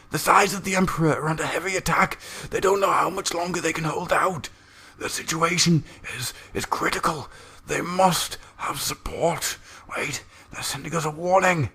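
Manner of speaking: high, far-off, urgent medium channeling a distant voice; an astropath in a trance, high and urgent; strained trance voice. Acted. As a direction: voice straining through the warp, high and pressed. Astropath in trance relays message.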